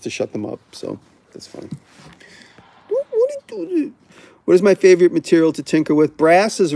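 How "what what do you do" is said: put-on very high, soft baby voice; put-on baby talk, pitched way up; baby troll voice. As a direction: squeak it like a mocking baby. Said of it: "the new troll voice is the baby voice".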